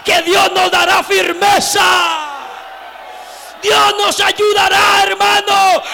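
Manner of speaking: full-force Pentecostal pulpit cry; fiery preaching. Acted. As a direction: preach it to the back row.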